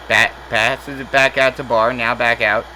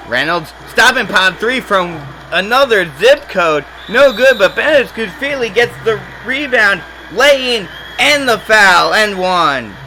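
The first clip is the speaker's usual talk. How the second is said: higher and louder, racing through the putback; excited play call; 7 semitones higher, 4 dB louder than usual. Real, not acted. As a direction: call the and-one with rising thrill. And-one putback in live commentary.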